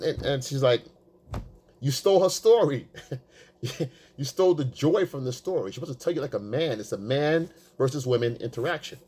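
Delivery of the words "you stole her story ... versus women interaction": ordinary delivery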